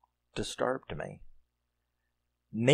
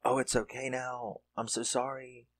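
soothing mother; 3 semitones higher, 8 dB quieter than usual. Retold or imitated. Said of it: "she's like".

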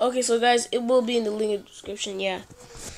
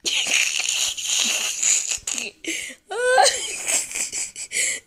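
cracking up hard; shrieking laugh; 10 semitones higher than usual. Real, not acted. Bursts out laughing at screen.

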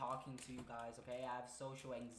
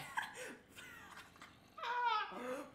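high helpless laugh, near crying. Real, not acted. Laughing at embarrassment.

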